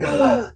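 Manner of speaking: sudden high gasp of being overwhelmed; big re-enacted gasp for breath; breathless gasp. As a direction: suck in air as though joy just struck you. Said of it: "like that right there".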